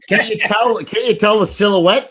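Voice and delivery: giggling through the words, high and bright; laughing. Real, not acted. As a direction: laugh while you tease him about the photo. Teasing over an old photo.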